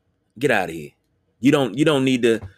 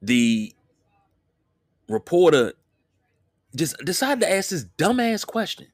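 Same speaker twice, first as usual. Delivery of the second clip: worked-up, higher outraged voice; indignant; 8 semitones higher than usual. Real, not acted. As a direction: rant it, pitch climbing in disbelief. Outraged rant about reporter.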